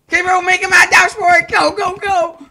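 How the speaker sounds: loud frantic urging; urgent shouting. Real, not acted. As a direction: yell go go go. Urging a mad dash.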